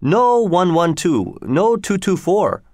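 high, slow, drawn-out alien pidgin; Tweel's repeated refusal. A narrator's reading. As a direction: stretch each word, pitched well up. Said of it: "he did the same thing again".

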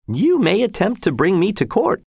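high, lightly formal character voice; courteous executioner. A narrator's reading. Executioner answering Arnold's legal objection, high and courteous. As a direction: answer the objection with unruffled politeness.

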